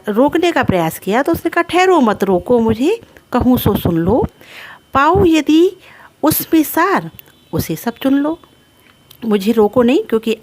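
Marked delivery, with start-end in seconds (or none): 1.6-8.4 high, emphatic poetic appeal; verse recitation. Acted. Kaikeyi to the assembly stopping her, raised recitation. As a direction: recite it as a plea to be heard.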